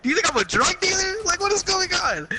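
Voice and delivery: can't believe what's happening; incredulous disbelief. Retold or imitated.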